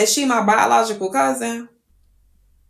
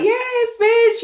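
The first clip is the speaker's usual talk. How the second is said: much higher, excited hype-up; hype cheer; 14 semitones higher than usual. Retold or imitated. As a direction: cheer your friend on while she dances. Friends hyping you up.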